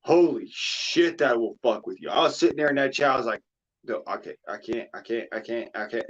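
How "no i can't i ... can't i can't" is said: soft, repeated refusal under the voice; retold helpless refusal. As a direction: replay being overwhelmed, softly. Acting out his own distressed reaction.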